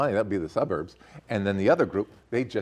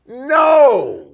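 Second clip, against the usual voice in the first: shouted preacher's no; impassioned shout; 11 semitones higher, 11 dB louder than usual. Real, not acted. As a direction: cry it out from the pulpit. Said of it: "Do I sound like a preacher there?".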